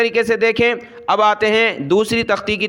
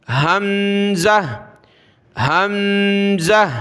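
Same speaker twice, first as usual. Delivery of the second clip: slow recitation; much slower than usual. Shown as a demonstration. Recites letters.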